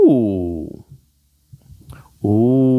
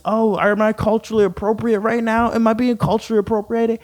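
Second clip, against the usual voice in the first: high, fast, whiny mock of a fretting poster; 9 semitones higher than usual. Vlogger mocking people who fret about appropriation, in a high whiny voice. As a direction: sneer at anyone fretting over appropriation.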